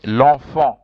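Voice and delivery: l'enfant said clearly, lon-fon with nasal vowels; model word to repeat. Shown as a demonstration. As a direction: give l'enfant firmly for echoing. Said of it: "So repeat after me".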